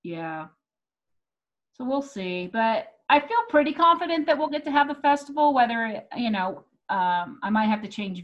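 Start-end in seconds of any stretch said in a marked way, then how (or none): none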